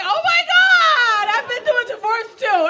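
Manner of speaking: high, slow, wailing flight attendants; everybody was like. Retold or imitated. Flight attendants sobbing along in sympathy, high and slow. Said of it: "Everybody was like".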